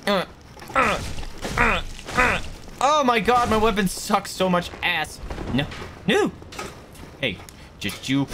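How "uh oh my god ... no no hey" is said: ordinary talk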